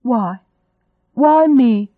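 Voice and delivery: high, loud lament; acted wail of protest; anguished outcry. A narrator's reading. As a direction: cry out in exasperated despair. Heroine cries out in pain.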